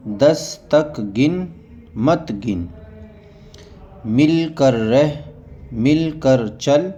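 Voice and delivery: each word said on its own, with pauses; reading drill. Shown as a demonstration. Teacher reads Qaida words to students, slow drill. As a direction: read the word list slowly for learners.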